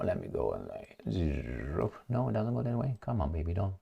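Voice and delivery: very low, hushed coaxing; muttering to himself. Real, not acted. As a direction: talk under your breath to a balky computer. Talking to the uncooperative computer.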